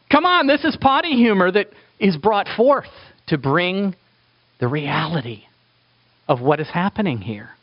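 ordinary talk

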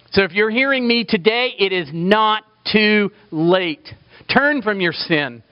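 high, loud, impassioned preaching; preaching at full force. Acted. Preacher urging sinners toward God's mercy, full-force preaching. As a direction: lift the whole room with a pleading sermon voice. Altar call to the congregation.

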